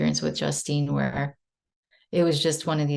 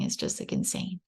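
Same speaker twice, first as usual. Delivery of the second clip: ordinary talk, nothing marked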